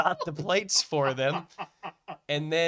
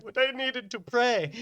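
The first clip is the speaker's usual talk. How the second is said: higher-pitched protest; defensive retold excuse; 7 semitones higher than usual. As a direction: plead your excuse, pitched up. Retelling own excuse to kitchen.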